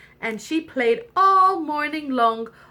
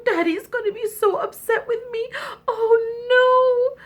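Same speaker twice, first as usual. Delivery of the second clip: high, tearful princess wail; hammed-up distress, on the edge of sobs; crying princess; 8 semitones higher, 4 dB louder than usual. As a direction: whine tearfully for help. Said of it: "she cried and she cried".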